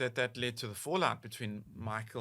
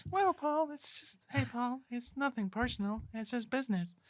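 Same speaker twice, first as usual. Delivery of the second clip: [name]; 10 semitones higher than usual. Acted. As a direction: answer in a high, put-on falsetto. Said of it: "and he was like".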